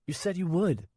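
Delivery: put-on high young boy's voice, pleading; a small boy reminding the man; child voice. Nico to Swen, a high boy's voice.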